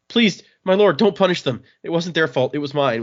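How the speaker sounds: panicked worker begging for mercy; frightened pleading. Retold or imitated. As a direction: plead for the workers like you fear the lord. Worker begs lord not to punish.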